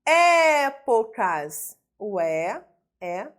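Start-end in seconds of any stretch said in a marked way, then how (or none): 0.0-1.8 "Épocas" with a very long, loud É; stressed syllable demonstration. Shown as a demonstration. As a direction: hold out the opening É of "Épocas". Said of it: "nossa sílaba tônica".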